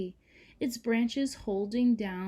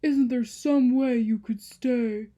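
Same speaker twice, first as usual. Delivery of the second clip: loud, slow pleading mountain voice; the mountain begging Joy not to leave; 4 semitones higher, 10 dB louder, slower than usual. Acted. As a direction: beg her to stay, drawn out. Mountain voiced in read story.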